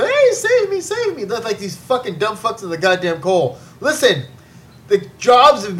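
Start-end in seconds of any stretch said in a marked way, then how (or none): 0.0-1.2 high, whiny cry of "save me"; mock plea for help. Mocking coal workers begging for a bailout, high whiny plea. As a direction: ham up a pitiful beg. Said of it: "now we gotta be socialists".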